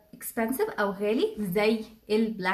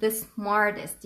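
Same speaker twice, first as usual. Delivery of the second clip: slow model phrase; much slower than usual. Shown as a demonstration. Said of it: "Or عمر is".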